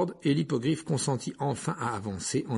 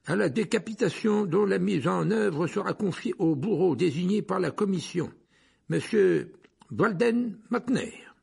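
high, loud official reading out a sentence; Fudge; 7 semitones higher, 4 dB louder than usual. A narrator's reading. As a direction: proclaim it formally, pitched high. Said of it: "pendant que Fudge continuait". (lecture officielle, voix haute et sonore)

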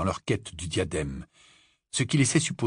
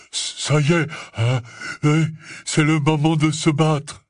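raised, winded big-man voice; panting; 5 semitones higher, 5 dB louder than usual. A narrator's reading. Hagrid asking Harry if it's time to fight, panting. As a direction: push it out in breathless bursts. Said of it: "s'exclama Hagrid d'une voix haletante".

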